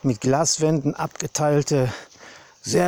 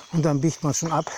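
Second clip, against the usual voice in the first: normal talking voice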